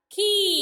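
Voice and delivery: खी called out loud, the long ई held; clear demo syllable. Shown as a demonstration. Teacher showing children the ई sound, loud and held. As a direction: sing out खी so the ई rings. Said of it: "ई का उच्चार हो रहा है देखो".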